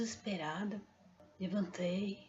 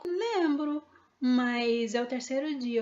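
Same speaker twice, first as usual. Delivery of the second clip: surprised; 4 semitones higher, 6 dB louder, slower than usual. Retold or imitated. Friend surprised at third day.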